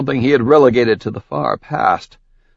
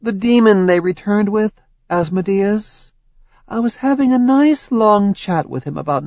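higher, accusing goddess voice; Hera's voice; 8 semitones higher than usual. Acted. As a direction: lay out his crimes coldly. Hera explains.

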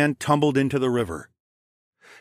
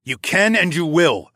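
loud, higher-pitched insistence; firm, raised-voice order; insistent command; 7 semitones higher, 5 dB louder than usual. A narrator's reading. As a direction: overrule his pleading forcefully. Forcing a bound man to talk.

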